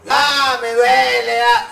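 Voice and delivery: high, loud imitated pained wail; mock cry of pain. Injected student imitated crying out that it hurts. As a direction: ham up the student's howl of pain. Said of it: "comenzó a gritar".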